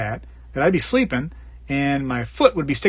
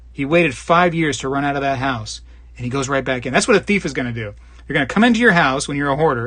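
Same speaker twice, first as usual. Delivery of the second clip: ordinary talk, nothing marked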